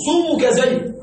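صومك said "sumuka", u after the s; u vowel in صومك, no opening vowel; sum variant. Shown as a demonstration. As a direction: pronounce صومك as "sumuka", dialect form of ismuka. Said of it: "ما معنى صومك زيد".

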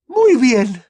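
high, loud, exasperated yell; shouting. A narrator's reading. Mother giving in to the children, shouting. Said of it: "gritó la señora Weasley".

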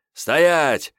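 high shouted command, falling at the end; piercing shout. A narrator's reading. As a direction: yell an order at someone running away. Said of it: "с пронзительными криками". (пронзительный крик-приказ, с падением в конце)

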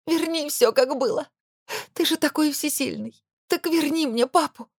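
high, sobbing plea; tearful pleading cry. Acted. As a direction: cry it out through tears. Истерика, слёзы героини.

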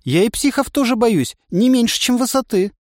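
put-on high, nervous girl's voice; scared girl. A narrator's reading. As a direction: confess fear timidly. Испуганная Настя. (испуганно, высоко)

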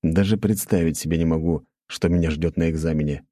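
ordinary delivery, nothing marked

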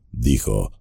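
ordinary delivery

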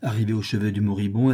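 ordinary delivery, nothing marked

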